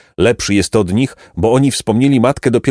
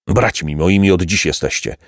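ordinary delivery

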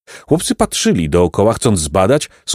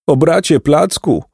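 high-pitched, mildly tearful lament; weeping; 7 semitones higher than usual. A narrator's reading. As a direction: wail lightly back to your brother. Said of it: "zapłakał Jacek". (wysoki, lekko płaczliwy lament)

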